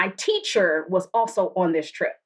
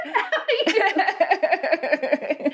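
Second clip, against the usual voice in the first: long, high burst of laughing; high real laughter; 11 semitones higher than usual. Real, not acted. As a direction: laugh freely at the story.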